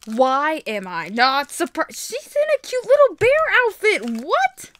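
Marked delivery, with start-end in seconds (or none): 2.0-4.7 loud, high gushing disbelief; high-pitched, delighted astonishment; amazed squeal. Real, not acted. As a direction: squeal in delight, leaping up on the last word. Amazed by cute outfit.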